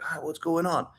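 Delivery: baffled inner voice, kept small; confused. Acted. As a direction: act mildly lost and thrown. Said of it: "you get confused".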